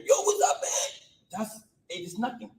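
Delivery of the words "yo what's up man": high, loud street greeting; big high-pitched holler, imitating; imitated yelled greeting. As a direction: mimic someone yelling a casual hello. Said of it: "they like to yell for everything".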